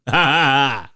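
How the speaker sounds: big "gotcha" cackle, rising above the talk; triumphant laugh. Retold or imitated. Retelling his triumphant return home.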